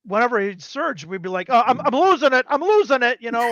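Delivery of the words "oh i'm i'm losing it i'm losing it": high, loud mock panic; retold mock scream. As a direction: imitate a wizard losing control. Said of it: "A wild mage guy screaming".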